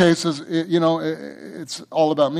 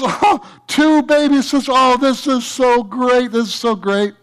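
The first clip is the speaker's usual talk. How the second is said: put-on delighted boy; 8 semitones higher than usual. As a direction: gush like an overjoyed kid. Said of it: "the little boy goes".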